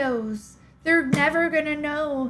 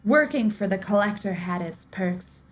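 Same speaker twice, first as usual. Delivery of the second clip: steady, measured storyteller read; audiobook-style narration of a mystery; story narration; 5 semitones lower than usual. A narrator's reading. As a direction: draw listeners into a dark tale. Reads a dark story aloud.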